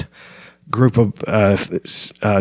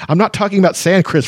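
higher, angry snarl; 9 semitones higher, faster than usual. Acted. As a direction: snap back at him. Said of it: "Flint snarled".

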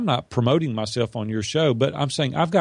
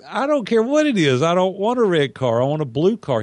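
louder, higher, insistent refusal; exasperated retort; 4 semitones higher, 3 dB louder than usual. Retold or imitated. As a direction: push back hard on the salesman. Refusing the red car, heated.

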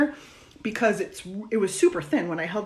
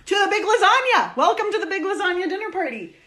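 excited; 10 semitones higher, 3 dB louder than usual. Real, not acted. Host announces party title.

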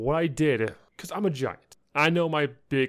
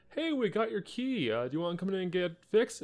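chirpy clerk; 7 semitones higher, 4 dB quieter than usual. Retold or imitated. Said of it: "they're like".

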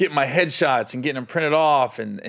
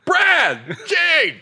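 high, loud mock hollering; imitated yelling; 13 semitones higher, 4 dB louder than usual. Headliner yelling for his crew from green room, mock hollering. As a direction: holler names as if down a hallway. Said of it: "in the green room yelling".

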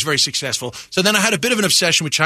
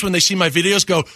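normal talking voice

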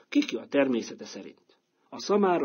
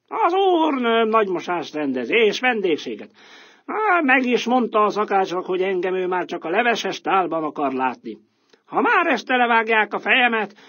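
louder and higher, crowing bravado; a doomed rooster shouting his last; 9 semitones higher, 7 dB louder than usual. Acted. Rooster telling the donkey of his fate, loud and higher.

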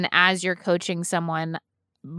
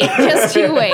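laughing mock threat; 5 semitones higher, 9 dB louder than usual. Repeats the threat while laughing.

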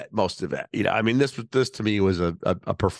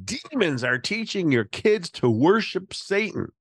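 mock outraged podcaster; 10 semitones higher than usual. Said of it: "conservative podcaster Liz Wheeler saying".

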